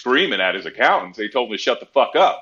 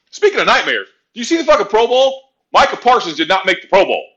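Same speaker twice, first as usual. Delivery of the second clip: outraged disbelief; 10 semitones higher, 5 dB louder than usual. Real, not acted. Outrage over Pro Bowl snub.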